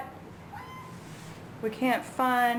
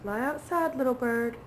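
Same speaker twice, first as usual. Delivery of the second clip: coaxing a wild bird; 4 semitones higher, 3 dB quieter than usual. Real, not acted. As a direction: lure the bird out gently.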